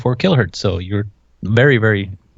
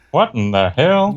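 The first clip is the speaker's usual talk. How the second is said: drawl; 4 semitones higher, slower than usual. Pretend. Joking bit.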